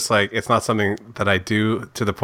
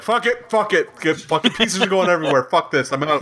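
much higher, laughing mock rage; rage quitting; 9 semitones higher than usual. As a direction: sputter it out like flipping the board. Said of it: "each of us was just like".

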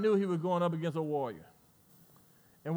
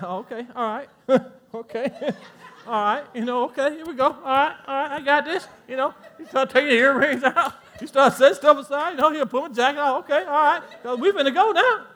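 mock gearing up for a fight; 6 semitones higher, 7 dB louder than usual. Acting someone gearing up to fight.

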